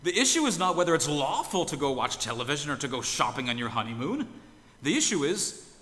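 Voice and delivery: exasperated outburst. Retold or imitated. The minister scolding the honeymoon couple, loud and exasperated. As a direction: throw up your hands and scold them, rising to a yell. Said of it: "a sigh of exasperation and he says".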